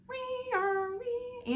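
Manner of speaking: high wailing wee-wee like a siren; ambulance siren imitation. Reader imitating the ambulance racing through the night with a siren wail.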